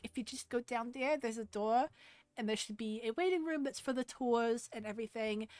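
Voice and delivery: New Jersey receptionist, gum-chewing; Jersey accent. Acted. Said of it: "I love doing Jersey accents".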